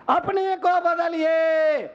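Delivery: high, drawn-out preacher's exhortation; rousing appeal. Real, not acted. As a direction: stretch it out high, urging the crowd. Preacher repeats appeal for force. (ऊँचे सुर में खींचकर जोशीली appeal)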